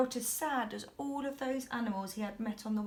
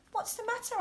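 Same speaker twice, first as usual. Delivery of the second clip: high voice; 11 semitones higher, faster than usual. A narrator's reading. George asks mouse, narrated.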